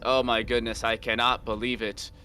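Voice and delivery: deadpan mock surprise in a level monotone; monotone sarcasm. Caster pretending a predictable ban amazes him, in sarcastic monotone. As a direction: feign amazement with no lift in the voice. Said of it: "the monotonous tone there".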